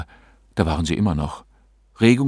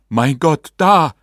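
loud, rising astonishment; startled exclamation; 6 semitones higher, 7 dB louder, slower than usual. A narrator's reading. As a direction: exclaim louder and higher, startled by the apparition. Husband startled as spirits appear.

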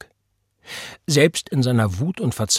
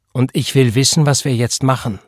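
higher, louder, pressing; forceful and impatient; insistent demand; 3 semitones higher, 7 dB louder than usual. A narrator's reading. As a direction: demand an answer, pushing each word. Pregnant woman demands an answer.

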